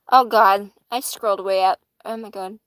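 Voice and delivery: ordinary delivery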